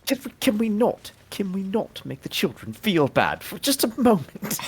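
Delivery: half-laughing plea to stop; exasperated plea. Acted. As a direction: beg them to stop, exasperated.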